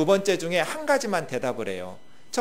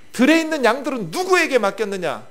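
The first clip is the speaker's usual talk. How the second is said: higher and louder accusing question; angry demand; 7 semitones higher, 6 dB louder than usual. Acted. Eliab angrily questioning David about the sheep. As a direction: demand an answer from the younger brother. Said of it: "막 분노하면서 화를 내면서".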